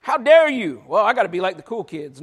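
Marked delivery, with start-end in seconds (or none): 0.0-0.8 higher, louder, hammed-up scolding shout; mock indignant colleague shouting in disbelief; mock outrage. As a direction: shout it like a scandalized minister. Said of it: "ministers are like".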